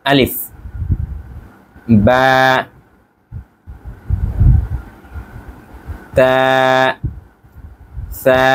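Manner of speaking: slow deliberate letter recital; teacher reciting the alphabet; Arabic letter names. Shown as a demonstration. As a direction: read each Arabic letter name with a clear pause. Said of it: "চলুন আরেকবার একটু আমরা পড়িয়ে দিই।".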